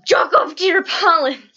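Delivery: loud punchline with a crying edge; mock shout. Telling viewers her first thought at the movie, a hammed-up shout. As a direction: belt the pun out like a battle cry. Her own pun, acted out.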